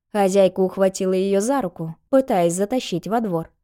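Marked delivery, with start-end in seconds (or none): none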